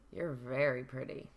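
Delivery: sleazy, quiet come-on; creepy catcall. Shown as a demonstration. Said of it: "sorry if I don't nail it right away".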